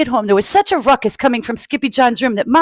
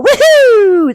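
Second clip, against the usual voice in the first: cheer; 14 semitones higher, 8 dB louder than usual. Acted. Reader cheers the party.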